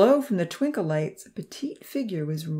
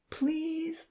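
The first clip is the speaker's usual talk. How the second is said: high, soft little plea; begging girl; 11 semitones higher, 7 dB quieter than usual. A narrator's reading. Lily to Odin, wanting her show, soft high begging. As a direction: beg like a small girl, softly. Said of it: "Lily begged".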